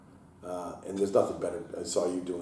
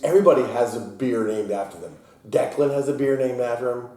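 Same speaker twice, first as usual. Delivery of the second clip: daughter's complaint; 4 semitones higher, 7 dB louder than usual. Retold or imitated. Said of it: "She goes".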